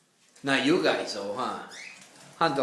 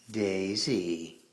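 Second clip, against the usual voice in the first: ordinary talk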